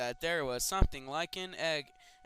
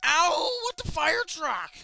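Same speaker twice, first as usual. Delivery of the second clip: high, loud, pained yelp; 13 semitones higher, 11 dB louder, slower than usual. Acted. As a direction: cry out as you hit your head. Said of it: "I yelped in pain".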